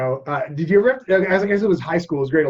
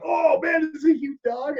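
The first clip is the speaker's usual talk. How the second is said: excited amazement; 12 semitones higher than usual. Retold or imitated. Retells own excited thought.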